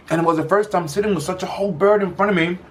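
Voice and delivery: high and eager, words tumbling; bursting with excitement; excited. Real, not acted. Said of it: "Sorry, I'm getting too excited".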